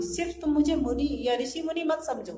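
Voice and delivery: normal talking voice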